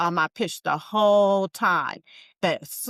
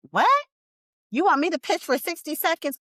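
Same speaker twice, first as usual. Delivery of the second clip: surprised disbelief; 11 semitones higher, 3 dB louder than usual. Retold or imitated. Retold disbelief at pitch request.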